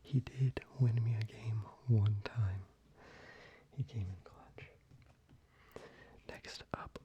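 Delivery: normal talking voice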